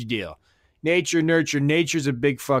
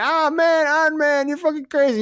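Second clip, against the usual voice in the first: much higher, excited mock gushing; amazed fan reaction; 14 semitones higher than usual. Viewer watching Iron Man first time, high excited gush. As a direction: gush like a fan blown away. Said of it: "you're like".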